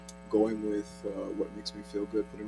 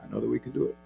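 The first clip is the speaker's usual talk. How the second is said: spoken word poetry; 13 dB louder than usual. Acted. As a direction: perform it like a slam poet. Performs spoken word poem.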